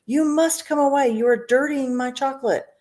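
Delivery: high, fussy protest; agitated cry. A narrator's reading. Factory owner fretting at the boy spoiling his chocolate. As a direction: hop up and down in alarm. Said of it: "hopping up and down".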